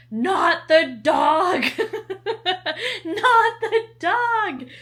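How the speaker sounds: high, loud, slow protest screamed through laughter; screaming while laughing. Retold or imitated. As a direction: shriek it, half laughing, half crying. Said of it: "And I'm just screaming".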